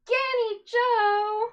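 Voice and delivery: high, slow, loud drawn-out name; excited. Real, not acted. Streamer calling out the donor's name in delight.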